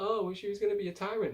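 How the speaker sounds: mock whiny retort; mocking dismissive quote. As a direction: sneer it back at him. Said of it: "And Dan's like".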